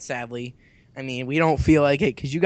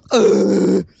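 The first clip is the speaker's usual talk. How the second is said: put-on baby grunt; 11 dB louder than usual. Baby's noise.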